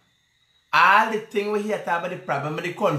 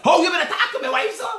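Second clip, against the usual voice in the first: high, fast, laughing mock quote; mock quoted caller; 11 semitones higher, faster than usual. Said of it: "You don't say".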